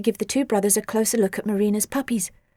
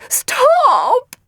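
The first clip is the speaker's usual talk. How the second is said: panicked squeak; 14 semitones higher, 8 dB louder than usual. Acted. Panicked creature repeats.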